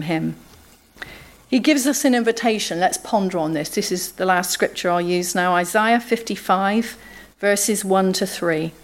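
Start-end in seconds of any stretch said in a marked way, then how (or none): none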